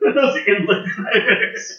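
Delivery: amused laughing. Real, not acted. Game master echoing the inland pirate joke, laughing. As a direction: repeat the joke through a laugh.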